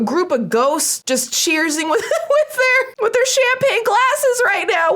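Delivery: high-pitched, laughing disbelief; cracking up. Real, not acted. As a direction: laugh through the words as your voice climbs. Cracking up at the ghost picture.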